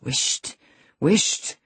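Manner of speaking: urgent hushing, breathed then voiced; muttered shushing; reproachful mutter. A narrator's reading. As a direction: hush her with reproach. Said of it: "muttered the young man reproachfully".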